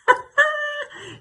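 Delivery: high, hammed-up titter; high comic laugh. Gossip delighted by her own retort, high comic laugh. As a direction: laugh shrilly at your own joke.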